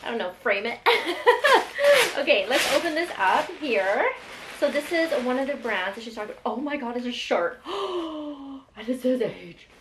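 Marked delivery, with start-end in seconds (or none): 0.8-2.1 loud, high burst of laughter. Real, not acted. Vlogger joking about framing the note, laughing. 7.7-8.7 high, drawn-in gasp of surprise; delighted gasp. Real, not acted. Vlogger unwrapping a shirt, gasping in surprise.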